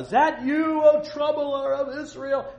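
huge, high, drawn-out shout; yell across a valley. Acted. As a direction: holler it to someone far across a valley. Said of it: "he'd yell across the valley".

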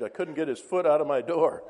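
mildly indignant complaint. Acted. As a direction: complain as a put-upon householder, lightly acted.

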